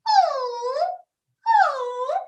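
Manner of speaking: put-on high, drawn-out "oh" like a small child; little kid's sound. Shown as a demonstration. Teacher demonstrates a child's sound, high kid voice. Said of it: "you might be a little kid".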